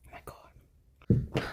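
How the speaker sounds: slow whispered oh my god. Real, not acted. Owner whispering in disbelief at the hyper cat. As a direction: breathe it out in a whisper, slowly.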